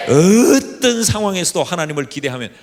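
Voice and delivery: loud, full-force preaching; preaching shout. Real, not acted. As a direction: proclaim it to the whole church. (힘찬 설교 외침)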